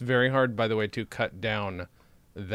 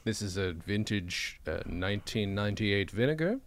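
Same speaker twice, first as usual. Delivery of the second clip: mock sommelier; 3 semitones lower, 4 dB quieter than usual. Host presenting aged cheap wine as vinegar, mock sommelier voice. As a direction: present it softly and solemnly like a fine wine.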